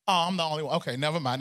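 ordinary talk

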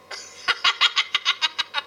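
rapid chuckle after own joke; amused cackling laugh. Real, not acted. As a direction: laugh in a quick run of short bursts. Laughs at own silly singing.

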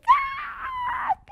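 very high, squealing cry of joy; reliving the call that she got the job, squealing; joyful scream. Retold or imitated. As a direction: shriek with disbelieving joy. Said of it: "me tiré al piso así de".

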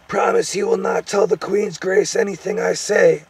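high, loud fearful plea; whimpering gnome. A narrator's reading. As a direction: plead anxiously as a little gnome. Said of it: "whimpered the gnome".

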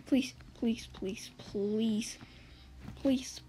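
unvoiced quick pleas; whispered pleading. Real, not acted. Gamer fleeing a cop, whispered pleas. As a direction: whisper it, praying not to be followed. Whispering hope not followed.

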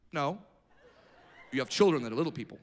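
usual speaking voice

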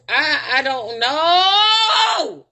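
long drawn-out whine on "know"; helpless, stretched-out cry of frustration; exasperated wail. Real, not acted. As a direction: wail it slowly, dragging the last word. Exasperated, at a loss what to do.

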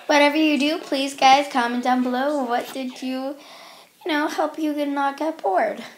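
normal talking voice

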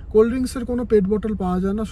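normal talking voice

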